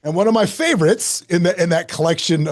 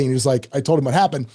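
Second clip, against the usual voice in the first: usual speaking voice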